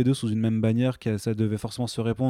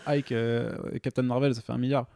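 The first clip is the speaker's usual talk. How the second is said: put-on Quebec French accent; 2 semitones higher than usual. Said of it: "Pourquoi tu lui fais un accent québécois?".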